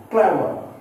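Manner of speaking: "clever" with CLEV high and loud, falling away; stress on first syllable. Shown as a demonstration. Teacher listing adjectives for learners, first-syllable stress. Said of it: "here also we stress on first syllable".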